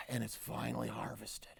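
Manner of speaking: very soft, spent near whisper; relieved hush. A narrator's reading. Berry finally harvested after pulling, relieved hush. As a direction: let it out quietly after the big effort.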